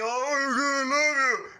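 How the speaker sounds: high, loud, slow mock drunk; drunk guy. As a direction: gush slowly like a sloppy drunk. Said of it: "He'll talk all you".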